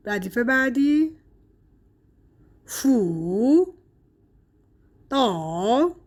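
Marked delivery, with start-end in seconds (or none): 2.7-6.0 "فو" and "دا" apart, each dipping then rising; two third tones. Shown as a demonstration. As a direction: say "فو", long pause, "دا", both in third tone.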